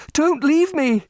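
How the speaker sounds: desperate cry. Acted. Repeated plea, higher, louder.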